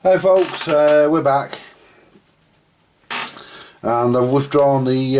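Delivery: usual speaking voice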